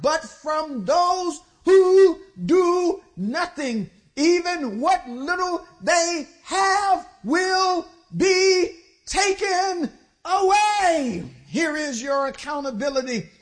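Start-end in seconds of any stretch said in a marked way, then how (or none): 0.0-11.2 higher, louder and very slow, weighing every word; preacher thunders the verse to the congregation, slow and loud; emphatic reading. Real, not acted. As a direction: drive the underlined verse home, slowly. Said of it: "Here it is, it's underlined".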